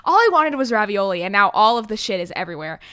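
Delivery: pissed-off shout over food gone wrong; real frustration. Retold or imitated. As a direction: yell it like you're truly fed up over ruined ravioli. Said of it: "then I got actually fucking pissed".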